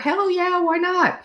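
high, eager yes; excited. Retold or imitated. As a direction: squeal it out eagerly. Eager self-answer.

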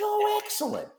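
very high, delighted mock wife; imitated wife, pitched far up with glee; wife's voice. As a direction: chirp it like the pleased wife.

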